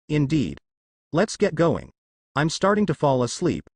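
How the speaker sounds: plain reading voice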